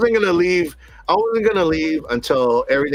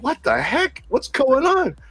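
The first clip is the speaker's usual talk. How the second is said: high-pitched, baffled question; retold bewilderment; 9 semitones higher, slower than usual. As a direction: replay the startled reaction, pitched well up. Retelling his startled reaction.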